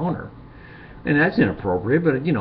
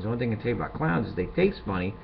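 normal talking voice

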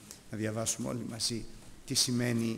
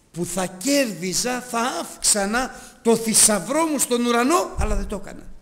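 fervent preaching; 9 semitones higher, 10 dB louder than usual. Acted. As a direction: preach it out with rising fervour. Preaching at full force. (δυνατό, ένθερμο κήρυγμα)

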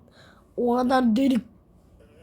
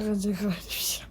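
soft unvoiced whisper; whispered aside; 3 semitones lower, 6 dB quieter than usual. Real, not acted. Vlogger talking about quitting the video, whisper. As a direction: breathe it out in disbelief.

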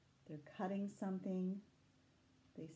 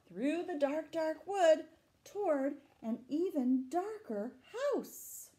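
higher, chase-like narration; reading the chase to children, higher and eager; excited story reading; 9 semitones higher, 8 dB louder, slower than usual. A narrator's reading. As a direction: urge them on after the beast. Reading story text dramatically.

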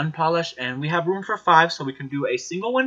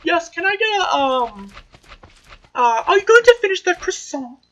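much higher squeaky customer voice; mock customer; 5 dB louder than usual. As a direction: ham up a silly customer ordering. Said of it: "be like".

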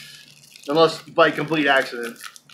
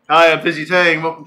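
loud, cheesy host intro; game show host; 9 dB louder than usual. Pretend. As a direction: greet the audience like a TV host. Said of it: "it's still a band name. Um, or game show host name".

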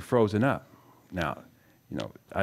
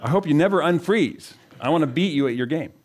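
mock gloat, higher and louder with a laugh; gloating rival; 8 semitones higher, 4 dB louder than usual. Preacher voicing a rival boss who wants him to fail, mock gloating. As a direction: crow over a rival's failure, hammed up. He should have said.

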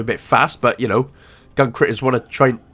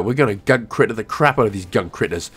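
usual speaking voice

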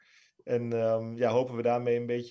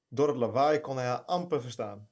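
ordinary talk, nothing marked

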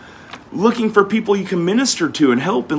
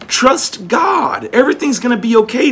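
calling out; 7 semitones higher than usual. Real, not acted. Preacher calls out to congregation.

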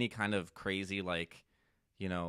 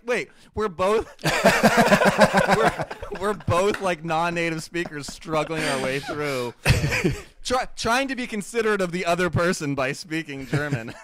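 loud, high, breaking up with laughter; real laughing; 6 semitones higher, 8 dB louder than usual. Real, not acted. Friend telling how two learners struggled in German, laughing hard. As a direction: push the words out through laughter. Cracking up at own punchline.

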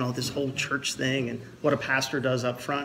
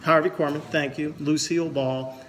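usual speaking voice

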